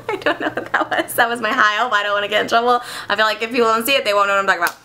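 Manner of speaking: usual speaking voice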